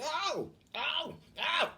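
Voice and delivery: high yelps of pain; hammed-up high ow; mock pain cries. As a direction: yelp like someone being hurt. Mother in pain, acted.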